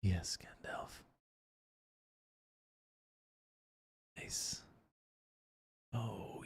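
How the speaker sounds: soft, breathy whisper right in your ear; sultry whisper. Acted. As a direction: whisper it close to the mic, sultry. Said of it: "That little sultry tone of my voice in your, your ear hole?".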